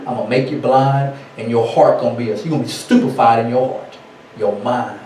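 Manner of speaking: low, soft voice of God; voice of Yah. Acted. Yah cursing his people, softly menacing. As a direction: lightly play God pronouncing a curse, low and quiet. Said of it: "And Yah said".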